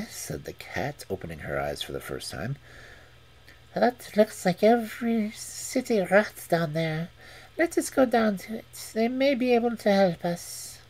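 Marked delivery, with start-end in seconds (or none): 3.7-10.8 cat voice. Acted. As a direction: purr it like a sly cat. Cat speaks.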